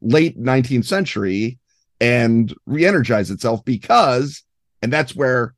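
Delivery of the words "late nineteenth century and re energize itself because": ordinary talk, nothing marked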